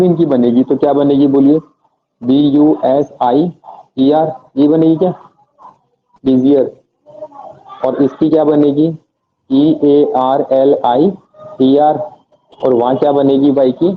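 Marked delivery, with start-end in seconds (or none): 2.2-4.3 slow letter-by-letter spelling. Shown as a demonstration. Teacher dictating spelling to students, slow. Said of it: "spelling busier on board". 9.5-12.0 slow letter-by-letter spelling. Shown as a demonstration. Teacher dictating spelling to students, slow. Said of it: "spelling earlier on board".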